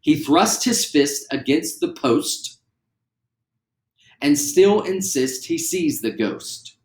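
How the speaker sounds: slow, every consonant hit; slow and clear. Shown as a demonstration. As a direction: spell out each word slowly. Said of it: "how clear you can say them".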